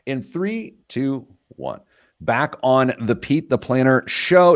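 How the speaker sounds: high, slow announcer countdown; playful on-air return voice; radio host countdown. Acted. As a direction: count the show back in like a DJ. Counting back into the show.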